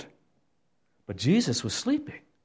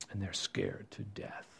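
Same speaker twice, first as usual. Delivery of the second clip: slow frightened whisper; scared whisper; 5 semitones lower, 18 dB quieter than usual. Real, not acted. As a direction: breathe it as if afraid. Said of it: "scared disciples".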